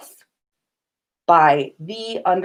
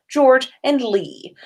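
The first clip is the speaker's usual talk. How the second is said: plain reading voice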